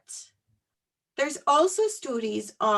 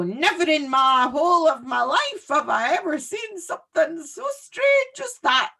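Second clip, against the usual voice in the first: hammed-up ancient Scottish fairy; ninety-five-year-old fairy, amazed at baking, in a Scottish accent; 5 semitones higher, 4 dB louder than usual. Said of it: "That was my Scottish".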